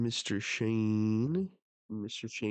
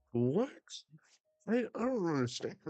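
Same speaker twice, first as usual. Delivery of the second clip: stammering higher, rushing in bewilderment; confused, higher and fast; 7 semitones higher than usual. Real, not acted. Reader reacting to a twist, high and bewildered. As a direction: sound lost and baffled, quick and high. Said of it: "I'm so confused".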